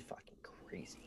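hushed confiding whisper. Real, not acted. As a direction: lean in and whisper the secret. Confiding a juicy reveal.

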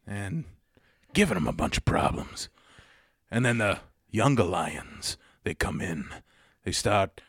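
quiet, threatening monologue with long pauses; Christopher Walken. Acted. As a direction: menace softly in halting, oddly broken phrases. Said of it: "when Walken gets quiet and threatening".

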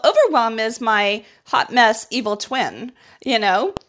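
ordinary delivery

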